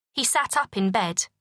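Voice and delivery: ordinary delivery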